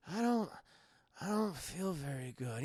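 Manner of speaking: quiet, weak drugged voice; imitating a woozy patient; groggy. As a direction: mumble it softly, barely awake. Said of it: "he's super fucking groggy".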